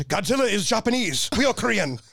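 high-pitched imitation of an offended officer; indignant Korean colonel. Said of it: "That's exactly what he said".

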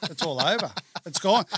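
loud comic announcement of your own death; mock doom. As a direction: declare the end, hammed-up. Comic retelling of believing he died.

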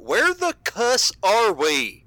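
loud, high, drawn-out exasperated cry; blurted outburst. Acted. Randall blurts out his confusion to the group, loud and exasperated. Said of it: "Randall just finally blurts out".

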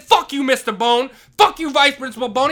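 angry yell. Retold or imitated. Janitor cursing out the vice principal, angry yell. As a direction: shout the insult at him. Retold shouting match with principal.

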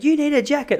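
high mock voice of a fussy lady; airport lady. Flight attendant warning him on the tarmac, mock high voice. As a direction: pitch right up for the lady on the tarmac. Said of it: "the lady said to me".

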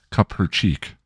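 ordinary delivery

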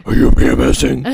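low, loud put-on superhero voice; Batman. Batman meeting the Riddler, mocked low voice. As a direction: push it down into a mocking hero growl. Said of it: "you always make fun of that voice".

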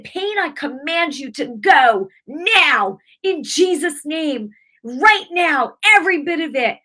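high, loud, slow command; commanding shout. Retold or imitated. Commanding the pain in her wrist to go, loud rebuke. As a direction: rebuke the pain with full force.

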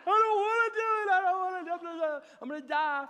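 high, hammed-up wailing of a panicked child; mock bawling. Son begging dad to skip the motorcycle ride, mock bawling. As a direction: imitate a kid bawling in protest. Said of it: "just bawling his eyes out".

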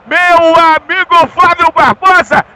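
ordinary talk

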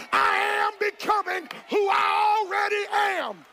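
high, loud, drawn-out shouts; climactic preaching. Acted. Pastor leading the congregation in a shouted declaration. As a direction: cry it out like a victory call.

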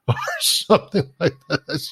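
high, helpless real laughter while talking; real laughing. Real, not acted. Coach recalling logos printed upside down, laughing. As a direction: laugh through the words, pitch jumping up. Laughs at absurd factory mistake.

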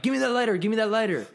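high, loud imitation of a worked-up coworker; frantic reenacted order; mock urgent demand. Coworker urgently demanding lighter, acted.

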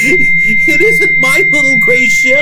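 high, loud, slow terrified plea; terrified horror victim. Acted. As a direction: plead slowly in terror. Host begging in terror.

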